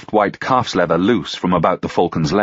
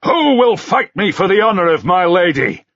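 loud, higher, daring demand; furious shout; 11 semitones higher, 4 dB louder than usual. A narrator's reading. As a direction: goad them to fight. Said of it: "he shouted furiously".

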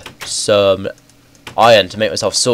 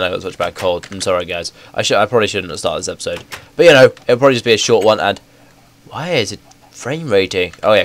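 ordinary talk

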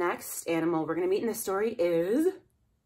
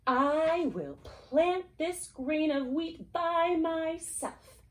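high, slow resolute hen; decide to do it alone; determined hen; 10 semitones higher, much slower than usual. Acted. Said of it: "said the little red hen".

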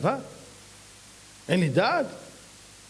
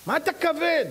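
mocking taunt; 11 semitones higher than usual.

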